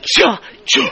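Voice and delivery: comic stammer stuck on the first sound; stuttering. Retold or imitated. Preacher reenacting how she tried to say John, stuttering. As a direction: stutter helplessly, half laughing. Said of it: "I start stuttering".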